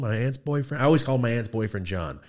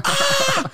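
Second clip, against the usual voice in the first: very high, loud comic shriek; imitated scream; 6 dB louder than usual. Man reenacting his first shock at the video game, imitated scream. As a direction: shriek like an astonished kid. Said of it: "and I screamed".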